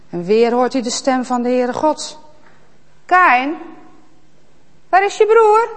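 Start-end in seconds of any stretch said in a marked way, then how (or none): 3.1-5.7 high, loud calling out; calling God. Acted. God calling Kaïn after the murder. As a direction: call for him across the field.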